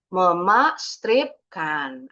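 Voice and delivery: "Memastripkan" slowly as mema, strip, kan; split at the hyphen. Shown as a demonstration. Teacher showing where to hyphenate, slow and split. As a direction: spell out the break in "Memastripkan", piece by piece. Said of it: "Kita penggalnya di sini".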